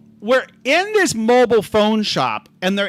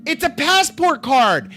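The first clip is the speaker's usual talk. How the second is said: exasperated insistence; 5 semitones higher than usual. Retold or imitated. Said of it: "I'm like".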